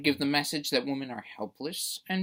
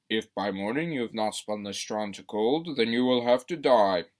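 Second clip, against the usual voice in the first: a lower, growing louder threat voice; low villain voice swelling louder at the end; menacing villain; 4 semitones lower, 5 dB louder than usual. Acted. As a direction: threaten her like a fairy-tale brute. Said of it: "He tells her that".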